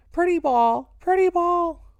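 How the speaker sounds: insistent high yelled "pretty ball"; demanding child. A narrator's reading. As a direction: shout it like a greedy little boy. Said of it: "Louis was demanding his due".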